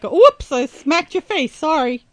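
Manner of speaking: sarcastic faux apology; mock innocent. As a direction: play the accidental slap innocently. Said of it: "It's just gonna be like".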